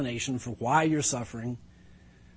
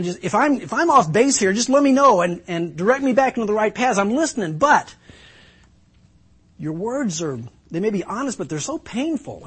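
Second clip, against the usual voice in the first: higher, louder hurt appeal; pained Job pleading; 6 semitones higher, 4 dB louder than usual. Acted. Job begging his friends for comfort, pained plea. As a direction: cry out to your friends for help in pain.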